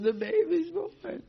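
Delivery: high, loud urgent cry; excited announcement. Retold or imitated. Guards rushing to tell Kamsa the baby's born, high loud urgent call. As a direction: burst in with the big news.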